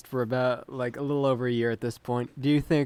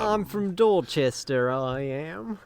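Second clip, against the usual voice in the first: a light Scottish lilt creeping in; slipping Scottish accent. Acted. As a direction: say it like a bit of a Scot. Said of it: "Did he just become Scottish?".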